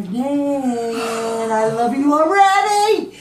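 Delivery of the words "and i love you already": loud joyful laugh through words. Real, not acted. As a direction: laugh it out with love. Mother loves unborn grandchild.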